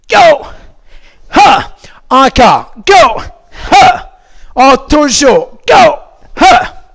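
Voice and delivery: sharp, loud, high karate cries; kiai shouts. Shown as a demonstration. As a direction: shout each kiai with full force. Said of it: "Dernière fois avec un kiai".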